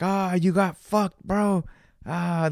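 high, slow mocking jeer; mocking laughter. Friends on FaceTime laughing at him, slow mocking jeer. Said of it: "they're fucking laughing".

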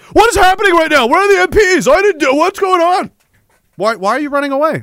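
high, loud frantic yelling; panicked officer. Acted. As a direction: blurt it out as you bolt in fright. Officer panics, flees interview.

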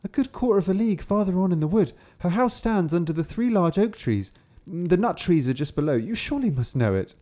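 put-on high, quick, innocent girl; little girl voice. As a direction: chirp it out like a trusting child. Kapturek odpowiada wilkowi.

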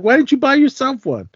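high, disbelieving ask; asking in high-voiced disbelief; incredulous question. Retelling his own question.

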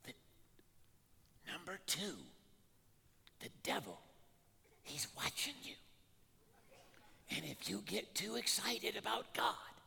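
furtive, hushed warning; whisper. Retold or imitated. Church lady warning that the devil is watching, whispered. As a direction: whisper as if someone might overhear. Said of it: "Why are we whispering?".

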